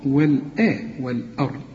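ordinary talk, nothing marked

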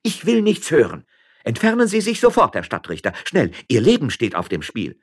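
high, forceful warning; furious woman refusing to listen; indignant miller's wife. Acted. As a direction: order him out, loud and urgent.

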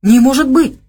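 loud, high stunned exclamation; can't believe what he heard; shocked disbelief. A narrator's reading. Lyosha stunned by news. (потрясённое восклицание)

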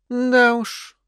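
high sarcastic «да уж». Acted. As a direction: drawl it out with sarcasm. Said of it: "саркастично заметила Айви". (саркастично)

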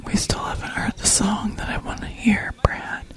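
soft whispered request; whispering. Real, not acted. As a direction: whisper it to the person beside you. Whispering to Brad.

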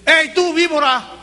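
grito de reproche a la víbora; llamado fuerte. Retold or imitated. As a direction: alza la voz como profeta que acusa. (grito de Juan el Bautista)

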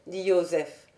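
usual speaking voice

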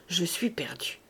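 hushed, whispered despair; inward thought. A narrator's reading. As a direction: whisper it to yourself, beaten. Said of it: "Fauchelevent pensa". (pensée chuchotée, accablée)

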